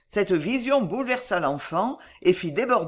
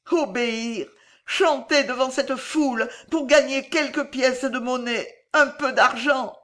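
high, grieving lament; désespoir en larmes; 6 semitones higher, 3 dB louder than usual. A narrator's reading. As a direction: lament as if through tears. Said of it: "le visage ruisselant de larmes murmura". (lamentation aiguë, désespérée)

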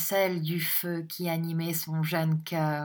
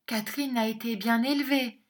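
higher, sighing mother's voice; 6 semitones higher, faster than usual. A narrator's reading. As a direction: say it on a sigh, pitched up. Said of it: "dit avec un soupir madame Nickleby". (avec un soupir, voix plus aiguë)

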